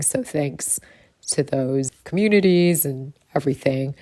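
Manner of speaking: ordinary talk, nothing marked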